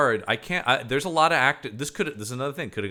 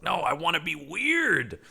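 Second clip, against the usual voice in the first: higher, slow, drawn-out insistence; mock defiant protest; 5 semitones higher, slower than usual. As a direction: play an actor stubbornly objecting. Voicing actor insisting on being weird.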